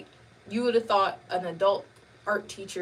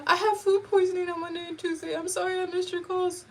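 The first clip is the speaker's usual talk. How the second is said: higher, sweet little-sister voice; 9 semitones higher than usual. Retold or imitated. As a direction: sound like you're buttering up big brother. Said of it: "Big brother".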